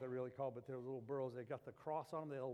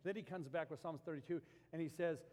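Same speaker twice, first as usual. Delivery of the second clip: usual speaking voice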